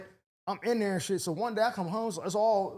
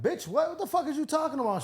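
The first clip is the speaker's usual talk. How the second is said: higher-pitched retold outrage; indignant disbelief; 8 semitones higher than usual. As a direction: replay a stammered, affronted comeback. Retelling quarrel with neighbor.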